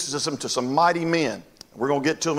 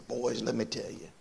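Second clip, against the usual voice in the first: hushed, much softer storyteller's voice; imitated old veteran; 2 semitones lower, 11 dB quieter than usual. Old Carl drawing the boys into his war story, hushed. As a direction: confide it quietly, as an old veteran.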